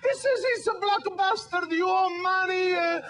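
put-on dad's thick Italian accent. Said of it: "thick Italian accent".

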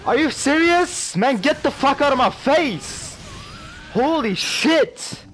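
loud, high-pitched gamer rage; real high, drawn-out shouts of outrage; outraged yelling. Real, not acted. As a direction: yell in disbelief at the screen. Gamer outraged at game play.

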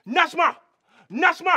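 appelle Najma très haut, de loin; calling out. Acted. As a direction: crie son nom vers la maison. Calling her again. (appel crié vers la maison)

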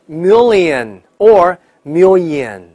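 million slow with double L, or million with dark L into y; drawn-out million both ways, mil-lion or mil-yun; two pronunciations. Shown as a demonstration. Teacher reviews two ways for learners, slow and emphatic. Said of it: "两种发音哈".

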